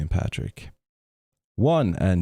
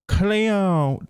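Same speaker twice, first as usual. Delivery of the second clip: very high-pitched bad Australian accent; terrible Australian accent; 14 semitones higher, much slower than usual. Showing off the only phrase known, terrible Australian accent. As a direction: squeal it in a badly faked Australian accent. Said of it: "That's a terrible Australian accent".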